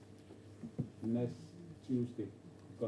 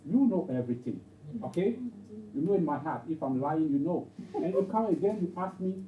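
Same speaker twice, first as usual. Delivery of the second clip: exasperated protest, louder; upset Peter; 5 semitones higher, 5 dB louder than usual. Acted. Peter asked by Jesus once more, upset and louder. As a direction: plead your case with raised voice. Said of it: "And Peter will be upset".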